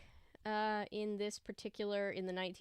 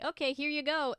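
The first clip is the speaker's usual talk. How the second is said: higher and louder, a chirpy fake helper; mock cheerful browser voice; 7 semitones higher, 7 dB louder than usual. Streamer mocking the browser's reply, chirpy and higher. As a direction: play the smug computer brightly offering tabs. Browser offers to restore tabs.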